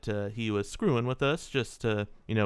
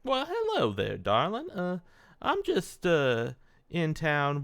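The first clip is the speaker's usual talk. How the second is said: charming; 6 semitones higher, slower than usual. Acted. Player flirts with the barkeep.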